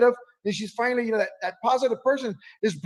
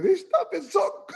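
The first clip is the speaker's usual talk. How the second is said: high, far-off comic scream; mock scream; 11 semitones higher than usual. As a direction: yell it from the next room. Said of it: "He might be screaming from over there".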